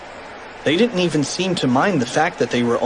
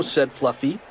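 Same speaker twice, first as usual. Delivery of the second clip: usual speaking voice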